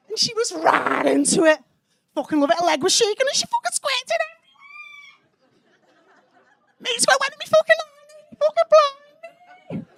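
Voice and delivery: excited boast climbing to a squeak; cocky year-nine boy. Acted. Boy swearing down to his mates, high cocky boasting. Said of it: "the cock of year nine".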